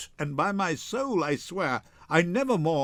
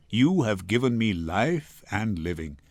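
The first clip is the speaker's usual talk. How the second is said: ordinary delivery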